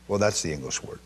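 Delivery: ordinary talk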